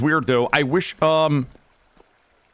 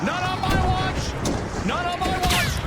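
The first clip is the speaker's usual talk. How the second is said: hero line; 14 semitones higher than usual. Pretend. Heroic revive call.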